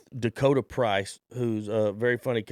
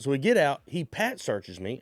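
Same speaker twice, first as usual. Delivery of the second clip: ordinary talk, nothing marked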